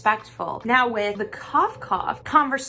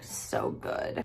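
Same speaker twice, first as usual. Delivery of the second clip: voiceless, much softer murmur; hushed savouring; 11 dB quieter than usual. Real, not acted. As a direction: drop to a near whisper, relishing it. Savouring the thought of tuna.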